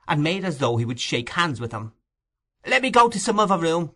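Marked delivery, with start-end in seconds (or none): 2.6-3.9 put-on high, hurried boy's voice; quick plea from a scared boy, pitched up; scared child backing away; frightened boy. A narrator's reading. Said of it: "said the boy, retreating still farther".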